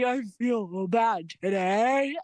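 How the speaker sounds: a touch of a sob, drawn out; telling the doctor, slow and weepy; mock crying. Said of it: "I was just crying".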